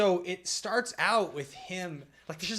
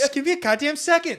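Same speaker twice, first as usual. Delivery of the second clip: high, loud, exasperated plea; mock panic; 11 semitones higher, 9 dB louder than usual. Scientist character needing a second, mock frantic. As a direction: imitate a flustered man begging for time. Imitating Wahlberg's panicked outburst in film.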